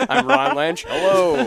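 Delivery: hammed-up anchor introduction through laughter; newscaster voice. Host parodying their old speech to friends, hammed-up newscaster voice. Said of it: "I just had normal newscaster accent".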